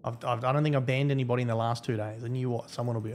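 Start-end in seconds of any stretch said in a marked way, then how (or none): none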